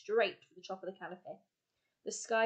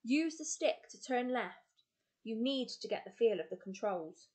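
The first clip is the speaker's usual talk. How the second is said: ordinary delivery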